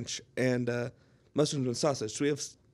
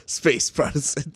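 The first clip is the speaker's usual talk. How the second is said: slower, louder repeat of the title; 7 dB louder, slower than usual. Acted. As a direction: call it out for the laugh.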